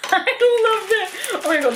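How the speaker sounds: high, slow, laughing through the words; laughing delight. Real, not acted. Sticking dinosaur on her mirror, laughing with delight. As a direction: giggle it out with glee.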